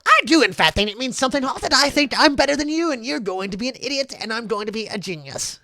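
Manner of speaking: high-pitched gloating character voice; cocky taunting retort. Acted. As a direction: brag at your opponent in a high, pushy voice. Cocky retort in acted quarrel.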